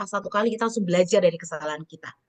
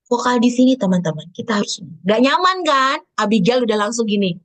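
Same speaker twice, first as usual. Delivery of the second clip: loud, overpowering projection; too-loud demo; 2 semitones higher, 6 dB louder than usual. Shown as a demonstration. Trainer startles learners with excess volume, loud. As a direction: boom it out uncomfortably loud.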